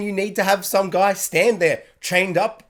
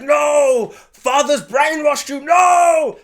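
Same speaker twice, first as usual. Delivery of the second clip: high, loud, drawn-out mock wail; anguished cry; 10 semitones higher, 7 dB louder, much slower than usual. Heartbroken brother betrayed by his brother, imitated anguished shout. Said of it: "He's, like, crying".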